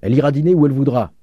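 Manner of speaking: fast, high, loud cry; loud, rapid rejection; shouted retort. A narrator's reading. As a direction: shout it like a heckling crowd. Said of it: "crièrent plusieurs voix".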